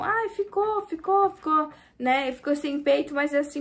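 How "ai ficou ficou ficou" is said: high, gushing imitation of the client; mock pleased customer. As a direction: ape the customer's eager approval. Mimicking customer trying on bikini. (imitação aguda e empolgada da cliente)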